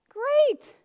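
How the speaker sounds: a squeaky sarcastic cheer; sarcastic great. Acted. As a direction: fake enthusiasm for the easy one. Sarcastic reaction to easy command.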